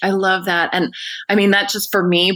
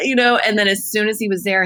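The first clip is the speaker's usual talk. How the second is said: ordinary delivery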